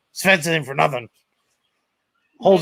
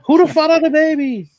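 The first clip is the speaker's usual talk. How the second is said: higher Jamaican psychic voice; Miss Cleo impression; 11 semitones higher, 4 dB louder, faster than usual. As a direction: do the TV psychic accent. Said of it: "Call me now".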